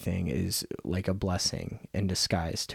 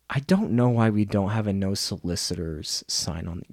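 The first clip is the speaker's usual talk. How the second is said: usual speaking voice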